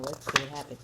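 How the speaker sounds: ordinary delivery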